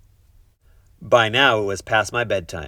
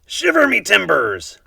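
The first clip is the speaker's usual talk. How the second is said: pirate voice; 11 semitones higher, 6 dB louder than usual. A narrator's reading. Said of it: "the pirate said".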